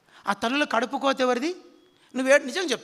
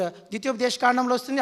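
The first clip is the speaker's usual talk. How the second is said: normal talking voice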